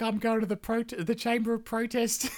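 high, mock-indignant announcement; huffy queen. Queen heading off to sulk, high mock huff. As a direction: announce your sulk grandly. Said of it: "she's probably like".

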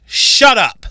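high, loud mock "caller" yell; retold angry outburst. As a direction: imitate a rude caller cutting someone off. Retold rude caller cuts her off.

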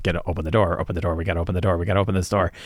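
hurried, panicky urging at the door; mock panic, begging them to open up; frightened party scrambling at the door; panicked pleading.

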